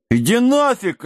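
high, touchy retort; offended. Acted. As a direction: snap back like a hurt big kid. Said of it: "обиделся Дибелмен".

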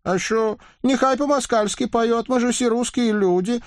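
put-on very high girlish rusalka; high rusalka voices. A narrator's reading. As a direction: squeal it in a high maiden voice. Rusalkas answer.

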